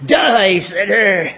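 put-on finger-wagging old man, lightly done; crickety old man. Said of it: "I just picture an old man with a crickety finger".

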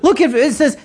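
normal talking voice

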